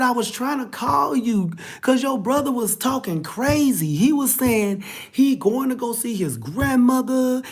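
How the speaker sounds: a hint of a frightened young woman, hurried then slowing; upset girlfriend. Girlfriend retelling brother's words.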